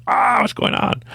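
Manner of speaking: high-pitched pretend panic; mock screaming. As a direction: imitate a frustrated man screaming in the woods. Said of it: "he's in the woods screaming".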